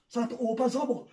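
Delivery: ordinary talk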